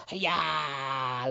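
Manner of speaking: a high mock battle cry of a dying hero; heroic last cry. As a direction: yell it as brave last words, hammed up. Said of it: "maybe your last words are".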